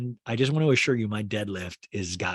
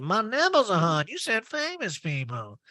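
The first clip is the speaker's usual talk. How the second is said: Erica perking up; 9 semitones higher than usual. Retold or imitated. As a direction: mimic someone suddenly perking up. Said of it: "Erica perks up".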